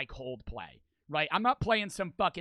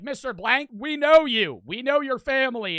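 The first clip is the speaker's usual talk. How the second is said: raised, higher voice; calling out; 6 semitones higher, 7 dB louder than usual. Retold or imitated. Friend pleading recognition to the homeowner, calling out. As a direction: call to someone you recognize at a distance.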